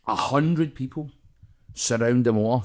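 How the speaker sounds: ordinary delivery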